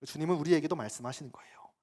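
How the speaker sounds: ordinary talk, nothing marked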